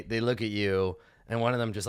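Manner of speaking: usual speaking voice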